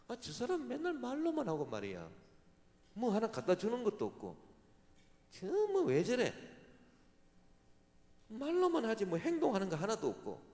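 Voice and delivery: mock grumbling complaint. 말만 하는 사람을 흉보는 교인, 비꼬듯 높고 큰 불평조. Mimicking people who complain about talkers.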